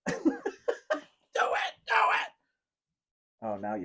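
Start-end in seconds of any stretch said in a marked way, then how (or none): 0.1-2.3 very high squeaky cry; squealed urging. Pretend. Urging cohost to send it, high squeal.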